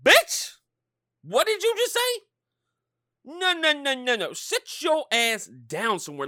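very high, loud indignation; outraged shout. Acted. Fan answering people who rank LeBron over Jordan, outraged shouting.

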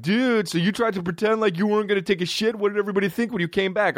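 high-pitched imitation of teasing friends; mocking openers. Openers ask him why he pretended, high-pitched mock disbelief. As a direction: push the voice way up high, imitating incredulous buddies.

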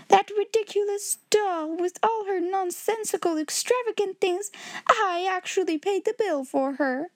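high-pitched outraged rant; indignant cry. A narrator's reading. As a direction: complain bitterly as an exasperated headmistress. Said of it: "cried Miss Minchin".

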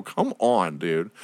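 usual speaking voice

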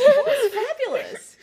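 high laughing exclamation. Real, not acted. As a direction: cackle it out. Mom cracking up over rust.

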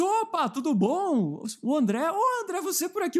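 high, slow, sing-song hello; cheerful greeting. Retold or imitated. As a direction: greet someone sweetly, stretched out. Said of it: "Já assim".

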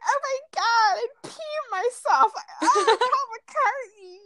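very high, slow fangirl squeal; gushing mock mom. Imitating mom as a screaming Beatles fan, mock swooning. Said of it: "She goes".

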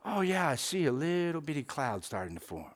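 soft, hushed servant reporting a tiny cloud; low-key servant's hushed answer to the prophet; servant's quiet report. Retold or imitated. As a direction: murmur it like a servant barely daring to say it.

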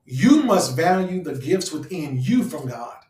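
emphatic swoop on "you"; stress on YOU. Real, not acted. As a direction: punch and stretch the word "you". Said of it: "I'm emphasizing on the you".

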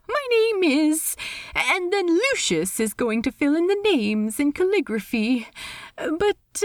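high, lilting, polite voice; awkward host in a high register; Steed, politely awkward. Acted. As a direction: pitch it high, hesitate politely. Steed explains the name tag.

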